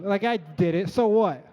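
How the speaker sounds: loud, high defensive comeback; aggressive, joking defiance. Real, not acted. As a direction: push back at the crowd, half laughing. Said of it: "got so aggressive just now".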